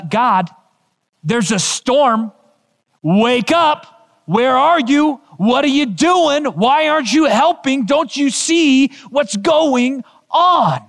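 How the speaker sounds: desperate believer calling on God; anxious prayer. Retold or imitated. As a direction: cry out to God in a storm. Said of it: "Maybe you're thinking".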